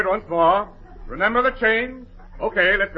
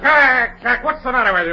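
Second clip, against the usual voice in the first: loud, high first call, easing off; fed-up director; exasperated scolding; 4 semitones higher, 5 dB louder than usual. Acted. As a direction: rebuke him out of lost patience.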